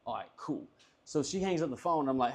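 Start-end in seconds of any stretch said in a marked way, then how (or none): 0.1-0.6 very soft inner voice; private thought. Retold or imitated. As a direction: quietly celebrate to yourself. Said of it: "in my head".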